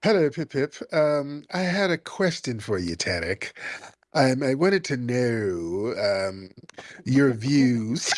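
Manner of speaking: mocking, sassy British caller; hammed-up British accent, sassy and teasing. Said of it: "With a sassy British accent".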